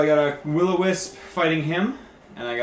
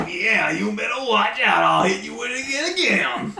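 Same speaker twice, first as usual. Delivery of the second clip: shrill taunting voice; 9 semitones higher, 6 dB louder than usual. Acted. Player taunting monster in character.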